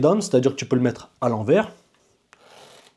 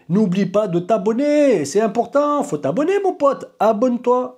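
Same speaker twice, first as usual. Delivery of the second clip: hammed-up plea; 9 semitones higher than usual. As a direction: plead playfully in a much higher pitch. (supplication surjouée, voix très aiguë)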